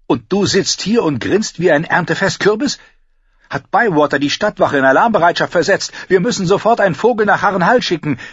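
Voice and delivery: loud, high, agitated; alarmed scolding. Acted. Cersei berating her grinning brother at war news, alarmed. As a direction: scold him in rising alarm.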